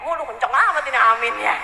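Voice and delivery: ordinary delivery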